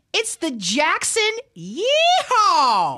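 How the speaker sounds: slow, drawn-out country yeehaw; cowboy yeehaw. Pretend. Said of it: "I can do that".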